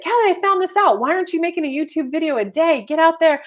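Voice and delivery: high, loud pushy mom; mom's urging. Retold or imitated. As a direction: play the mom nagging her to get out there. Mom voiced urging her.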